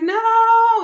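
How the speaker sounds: high, loud drawn-out no; a petulant child's no; whiny protest. Retold or imitated. Protesting her husband's threat to leave, high loud whiny no. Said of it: "And I'm like".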